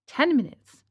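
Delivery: ordinary delivery, nothing marked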